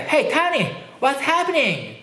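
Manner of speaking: high-pitched American accent with open vowels. Shown as a demonstration. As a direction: hail a friend in broad American English. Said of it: "アメリカって". (高めの声でアメリカ英語の発音)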